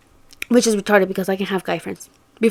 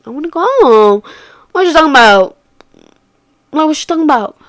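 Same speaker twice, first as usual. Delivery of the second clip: irritated protest; 5 semitones higher, 8 dB louder than usual. Retold or imitated. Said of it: "I was kinda, like, pissed".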